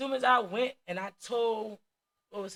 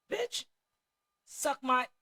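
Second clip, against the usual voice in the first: mock cuss out; 5 semitones higher, much slower than usual. Cussing out the teacher.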